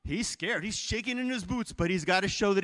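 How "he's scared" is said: ordinary talk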